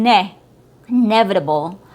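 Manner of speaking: "inevitable" as i-NEV-itable; e sound. Shown as a demonstration. Teacher isolating the right syllable, short E. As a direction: land on open NE in "inevitable". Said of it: "sound from an e".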